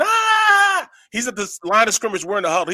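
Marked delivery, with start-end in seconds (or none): 0.0-0.9 high, loud mock battle scream; war scream. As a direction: scream like a painted lineman at the line. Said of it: "you know, screaming".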